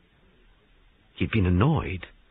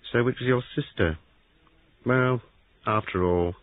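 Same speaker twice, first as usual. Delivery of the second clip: ordinary delivery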